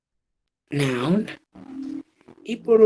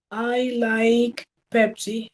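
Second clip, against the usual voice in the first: slow example sentence; 4 semitones higher, much slower than usual. Shown as a demonstration. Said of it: "voy a utilizar una conjunción".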